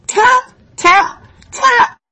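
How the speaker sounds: high, loud tap tap like knuckles on a door; imitated knocking. Wolf knocks, girl arrives.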